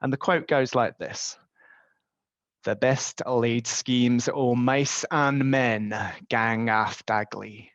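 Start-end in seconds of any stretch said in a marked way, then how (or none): 2.6-7.7 put-on slow, deliberate Scottish accent reading a Burns line. Presenter quoting Rabbie Burns to webinar colleagues, slow Scottish accent. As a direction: recite it like a Scot married into Glasgow, slowly. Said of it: "forgive me, uh, for my Scottish accent".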